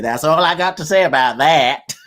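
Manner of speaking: lightly imitated Southern film character, timbre changed; playful take on the movie catchphrase; Forrest Gump. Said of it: "Forrest Gump".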